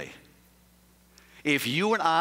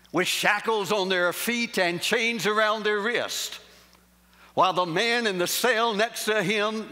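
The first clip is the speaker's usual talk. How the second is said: building preacher fervor; 6 semitones higher than usual. Acted. Preacher to congregation, building fervor. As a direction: build the picture of the cell with mounting fervor.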